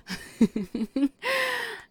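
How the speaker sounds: high giggle cutting into the line; playful laugh. Acted. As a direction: laugh at him mid-sentence. Laugh mid-sentence, teasing.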